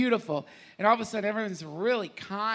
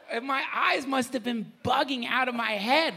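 ordinary delivery

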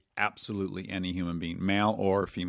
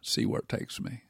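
Matthew McConaughey impression; 9 semitones higher than usual. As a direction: hush it out, laid back and dreamy. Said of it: "Did you know you could do this impression?".

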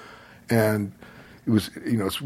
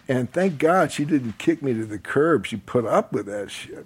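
ordinary talk, nothing marked

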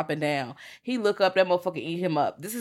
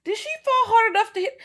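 much higher, disbelieving; incredulous question; 4 dB louder than usual. Retold or imitated. Reacting to the fainting scene, high incredulous disbelief. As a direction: ask in mock disbelief. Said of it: "And I'm like".

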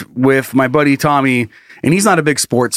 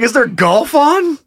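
high, slow, drawn-out mock question; teasing brother; 10 semitones higher, slower than usual. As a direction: brother teasing about the wrong sport.

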